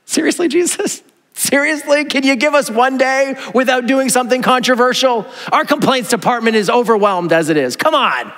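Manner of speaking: playful whining disbelief, pitched high; an overworked disciple pleading, half laughing; exasperated disciple. Pretend. Disciple complaining to Jesus, mock exasperated. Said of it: "If I'm a disciple, I'd be like".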